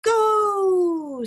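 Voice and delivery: high, loud launching go; storybook countdown's shouted finish. A narrator's reading. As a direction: fire off the signal. Launch command, building countdown.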